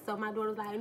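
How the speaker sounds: ordinary delivery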